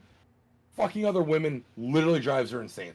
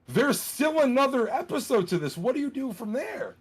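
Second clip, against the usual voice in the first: disbelief; 9 semitones higher than usual. Real, not acted. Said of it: "Wait, there's still another episode".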